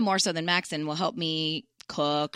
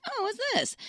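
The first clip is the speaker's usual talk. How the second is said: mock surprise. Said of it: "So I was like".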